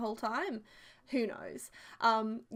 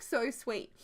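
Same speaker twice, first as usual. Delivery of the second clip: ordinary talk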